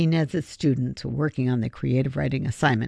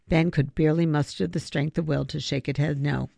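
usual speaking voice

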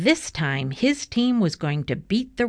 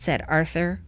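usual speaking voice

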